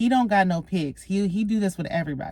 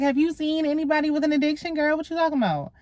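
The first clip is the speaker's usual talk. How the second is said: incredulous quote; 9 semitones higher than usual. Retold or imitated. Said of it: "he's like".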